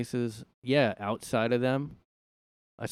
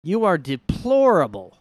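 loud, high swoop on deplorable; hammed-up condemnation; 6 semitones higher, 10 dB louder, slower than usual. People throwing an insult at someone, hammed-up condemnation. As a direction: draw it out like a damning verdict. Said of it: "Like, people say".

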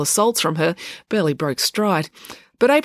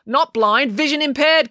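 higher, louder and primly insistent; the boy primly correcting Joe; 8 semitones higher, 4 dB louder than usual. Acted. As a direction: insist on the right word. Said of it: "corrected the boy".

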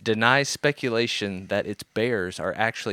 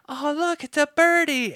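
put-on very high, sweet little girl; 4 dB louder than usual. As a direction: squeak it like a small child. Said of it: "this little girl's like".

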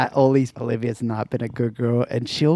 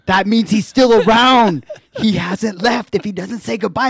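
higher, louder, slowing ominous warning; mock spooked urgency; 8 semitones higher, 7 dB louder than usual. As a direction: warn like a scared teen about a ghost. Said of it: "He's like".

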